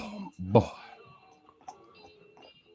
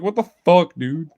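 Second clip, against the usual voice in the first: higher, louder start, dropping on the last word; mock mad; 5 semitones higher, 5 dB louder than usual. Robbed dog to the bone thief, mock indignation. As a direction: imitate an indignant dog, lightly outraged. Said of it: "She's mad, bro".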